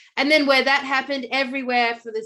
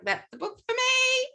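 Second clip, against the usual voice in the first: high, bright eureka; thrilled at finding the book; delighted realization; 14 semitones higher than usual. Retold or imitated. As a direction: squeal it like you found treasure. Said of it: "And I was like".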